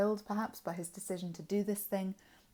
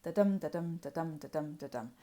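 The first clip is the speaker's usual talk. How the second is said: "da-dum" with the "dum" hit hard each time; iambic rhythm. Shown as a demonstration. Teacher demonstrating iambic pentameter to students, beating out "da-dum". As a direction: tap out "da-dum" as weak then strong beat. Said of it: "a pattern of unstressed, stressed, unstressed, stressed".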